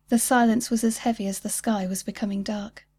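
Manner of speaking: plain reading voice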